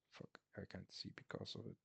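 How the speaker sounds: hushed unvoiced grumble; muttered complaint under the breath; real whisper. Real, not acted. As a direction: whisper it so nobody nearby hears. Whispering in the cinema seat.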